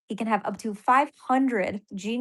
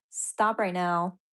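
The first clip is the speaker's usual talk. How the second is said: ordinary talk, nothing marked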